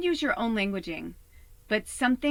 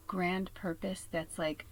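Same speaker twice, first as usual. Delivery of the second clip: ordinary talk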